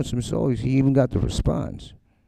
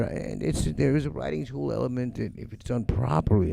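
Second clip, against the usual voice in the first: normal talking voice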